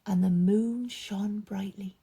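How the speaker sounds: lively, expressive storytelling voice; story narration for children. A narrator's reading. Storyteller reading a Bible story aloud to children, animated. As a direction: paint the story big for the kids.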